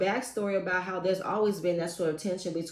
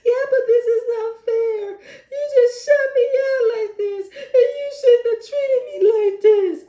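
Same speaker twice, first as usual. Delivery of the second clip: far higher and louder hammed-up sob; mock sobbing; 10 dB louder than usual. Sister pleading with Tierra, exaggerated crying wail.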